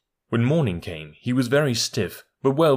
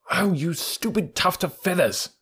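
angry; 8 semitones higher than usual. Acted. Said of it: "said Rikki Tikki angrily".

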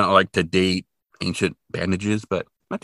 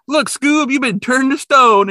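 high, louder cartoon Shaggy, tearful; 6 dB louder, slower than usual. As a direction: pitch way up as a weepy cartoon sidekick. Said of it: "And then Shaggy is like".

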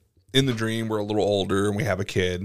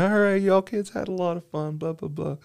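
put-on higher old dad voice, trailing off; old parent voice; 9 semitones higher than usual. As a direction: play the tired old dad to kids. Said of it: "and like".

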